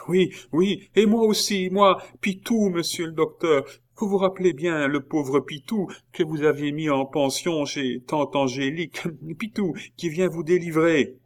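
high-pitched, breathless-to-be-recognised excitement; excited eager youth. A narrator's reading. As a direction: pipe up eagerly to the doctor. Pitou eagerly makes himself known. (voix aiguë, empressée et joyeuse)

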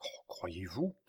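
whispered, soft question; hushed hope. A narrator's reading. Old man hearing that his child may live, whispering. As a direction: ask it under your breath, barely daring.

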